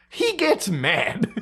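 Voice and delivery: he gets mad drawn out slowly through a laugh; laughing, slowed-down punchline; slow laughing emphasis. Real, not acted. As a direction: lean on mad, slow and amused. Punchline repeating his own phrase.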